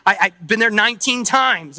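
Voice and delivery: ordinary talk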